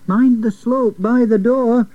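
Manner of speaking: high warning call; cry of alarm. A narrator's reading. Stanley warns those pushing the wheel, a cry of alarm. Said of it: "his cry of alarm".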